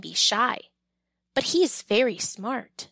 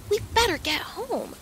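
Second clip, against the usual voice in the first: high urgent shout; 9 semitones higher than usual. Acted. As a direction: call out over the rain. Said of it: "Squirrel shouts".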